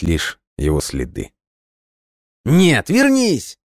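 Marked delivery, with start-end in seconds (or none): 2.4-3.6 very high, long-drawn shout; furious scream. A narrator's reading. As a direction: scream after someone leaving, stretching the words. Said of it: "в ярости закричал он".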